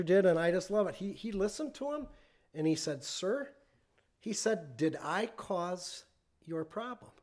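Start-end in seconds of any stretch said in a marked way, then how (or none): 3.0-3.5 soft, composed address; calmly imitating an unruffled service manager. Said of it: "he said it very calmly". 4.8-7.1 imitating an unruffled manager facing an angry customer; calm. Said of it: "he said it very calmly".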